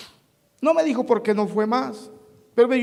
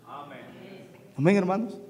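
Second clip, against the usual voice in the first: ordinary talk, nothing marked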